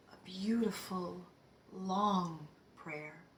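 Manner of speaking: soft, breath-heavy savoring of each word; quietly moved, words spaced out; hushed reverence. Real, not acted. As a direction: linger on it, nearly whispering. Moved, recalling stranger's prayer.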